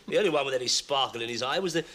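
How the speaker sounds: normal talking voice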